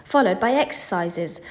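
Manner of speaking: ordinary delivery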